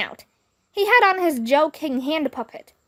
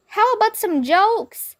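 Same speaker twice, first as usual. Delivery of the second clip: put-on much higher kid's call; higher mock kid yell; kid shouting; 9 semitones higher, 3 dB louder than usual. A narrator's reading. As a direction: shout a request from the crowd. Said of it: "shouted some other kid".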